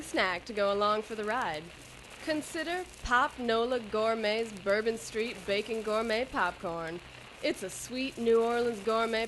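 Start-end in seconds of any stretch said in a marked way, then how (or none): none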